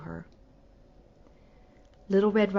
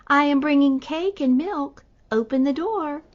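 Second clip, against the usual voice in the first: put-on higher and louder, a wolf faking a girl; wolf at the door posing as the girl; high pretend voice; 6 semitones higher, 5 dB louder than usual. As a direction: keep up the girlish disguise. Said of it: "in a very high pretend voice".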